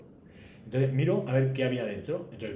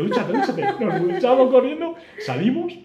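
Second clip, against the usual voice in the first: urgente; 11 semitones higher, 8 dB louder than usual. Retold or imitated. Prisa por escapar del hotel.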